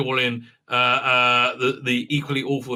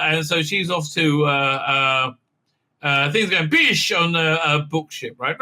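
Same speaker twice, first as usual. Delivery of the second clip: normal talking voice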